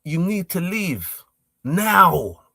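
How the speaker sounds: slow, emphatic order with a punched final word; firm retold order. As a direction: order them out, dragging out each word. Repeating order to the driver.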